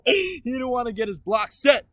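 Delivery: high, loud exclamation; excited shouted punchline; whooping echo. Real, not acted. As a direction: holler it back at your co-host. Exuberant echo of co-host.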